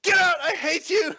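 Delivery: high, screeching accusation ending in a shout; furious screaming. Acted. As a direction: hurl it at a boyfriend you can't stand. Girlfriend screaming in lovers' quarrel.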